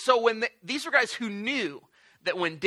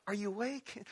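hushed question; 12 dB quieter than usual. Retold or imitated. Quietly checking if Jesus woke.

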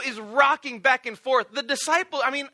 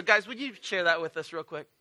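ordinary talk, nothing marked

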